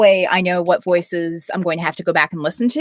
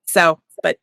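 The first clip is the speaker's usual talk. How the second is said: normal talking voice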